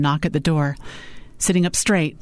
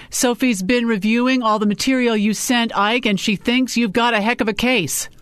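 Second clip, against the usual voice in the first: higher salesman-like interjection; lightly voiced Rick, glib; 7 semitones higher than usual. A narrator's reading. Boss interrupts to flatter the client, glib and higher-pitched. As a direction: cut in and talk up the case.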